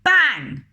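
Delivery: loud high shouted bang; door bang. Acted. Reader shouting the slammed door sound, loud. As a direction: slam the door with your voice. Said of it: "They slammed them".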